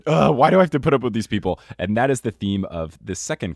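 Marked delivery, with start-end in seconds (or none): 0.1-1.6 mock exasperated. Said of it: "He's just".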